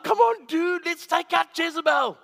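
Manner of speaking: loud, high hype call to battle; rally him like a gym buddy; shouting come on dude, high and loud; pumped-up buddy. Pretend. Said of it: "I would be like".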